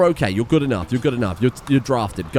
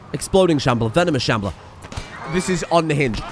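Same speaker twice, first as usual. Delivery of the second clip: usual speaking voice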